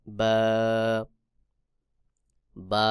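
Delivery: বা held long, made with the wet part of both lips; letter demonstration. Shown as a demonstration. Teacher shows the letter ba, বা from both lips. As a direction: press both lips, then draw out বা. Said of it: "উভয় ঠোঁটের ভেজা অংশ থেকে".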